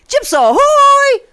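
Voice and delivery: high, drawn-out sailor's hail; catchphrase call. Pretend. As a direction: call it out like "ship ahoy", stretched and high. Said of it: "you know what we say".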